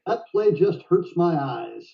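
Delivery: slow, pained Texas accent imitation. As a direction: savour each word like an old Texan wincing at a bad move. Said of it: "in his little Texas accent".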